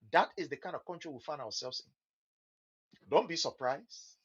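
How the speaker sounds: ordinary talk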